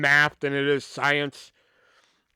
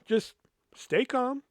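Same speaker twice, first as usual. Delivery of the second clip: calming himself; 6 semitones higher, 5 dB quieter, slower than usual. Retold or imitated. Telling himself to keep calm.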